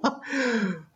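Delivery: high delighted laugh; laughing excitedly. Real, not acted. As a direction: laugh out your joy. Excited shared pick.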